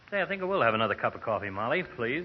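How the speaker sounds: usual speaking voice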